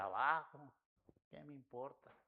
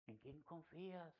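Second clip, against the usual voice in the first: much softer, slow "¿En quién confías?"; soft gentle question; 2 semitones higher, 13 dB quieter than usual. Retold or imitated. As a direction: ask it gently, almost under the breath. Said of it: "ella me decía".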